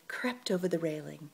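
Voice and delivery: plain reading voice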